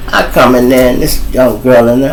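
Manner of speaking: normal talking voice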